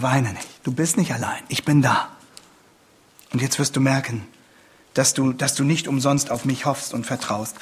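low, soft, consoling voice; tender reassurance. Acted. Jesus telling the grieving widow she is not alone, tender reassurance. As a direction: comfort them quietly, pitched well down.

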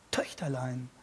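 Töchterlein said softly and tenderly. Shown as a demonstration. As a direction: breathe out Töchterlein gently. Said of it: "wenn man vielleicht besonders bewegt ist". (zärtlich und leise „Töchterlein“)

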